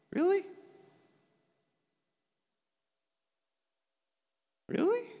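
incredulous. Acted. Answering someone wallowing in self-pity: incredulous. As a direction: scoff at the self-pity in disbelief.

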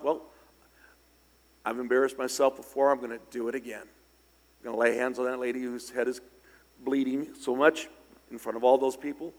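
ordinary talk

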